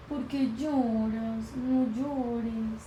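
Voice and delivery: lightly voiced toddler, slow and soft; a hint of a small child comforting gently; little girl voice. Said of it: "Entonces iba y nos tocaba la mano".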